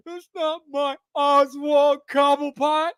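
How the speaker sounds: put-on high, slow, whining man-child voice; whiny fan impression. Parodying fans upset about the name change, high and whiny. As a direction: whine it like a sulking fanboy.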